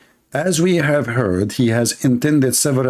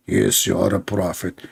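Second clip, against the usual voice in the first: lower, mock-solemn reassurance; solemn angel; 5 semitones lower, 4 dB quieter than usual. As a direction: confirm it like a grave heavenly messenger. Acting angel's solemn reply.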